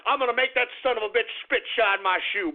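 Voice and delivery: ordinary talk, nothing marked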